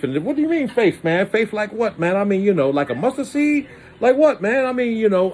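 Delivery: high, pleading voice; whiny questioning. Pretend. New Jesus questioning his faith, high whiny pleading.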